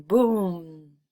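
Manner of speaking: usual speaking voice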